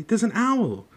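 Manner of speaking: high, loud urgent cry; loud alarm. Acted. Harry shouts to Hagrid about the owl, high and loud. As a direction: raise the alarm. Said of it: "said Harry loudly".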